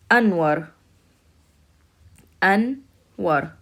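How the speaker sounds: Anwar with a rolled ending; teacher models Anwar; model word. Shown as a demonstration. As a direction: say Anwar slowly and clearly. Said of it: "Right here".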